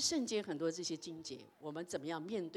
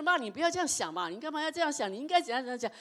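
raised, louder scolding of mom; nagging mom; 7 semitones higher, 4 dB louder, faster than usual. Retold or imitated. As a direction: nag back at mom, higher and louder. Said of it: "啊，念她". (对妈妈烦躁地念回去，声音拔高)

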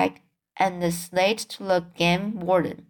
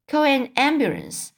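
higher-pitched, understated narrated scream; mild mock scream; 6 semitones higher than usual. A narrator's reading. Lady in the crowd calling for help, mildly screamed. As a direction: read a lady's cry with lifted pitch. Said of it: "screamed a lady".

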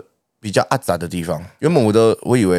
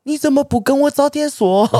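mock outrage. Retold complaint, pitched up.

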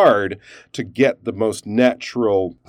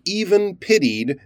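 raised, emphatic even pitied; stressed aside; 10 semitones higher than usual. Shown as a demonstration. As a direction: hit even pitied as its own little bracket. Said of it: "stress the word pity and maybe even have a pause".

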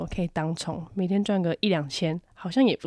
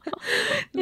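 laugh. Real, not acted. Laughing at own story.

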